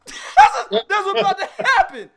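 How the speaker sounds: words breaking up with laughter; fast, giggling through the line; laughing. Real, not acted. Laughing at imagined fight.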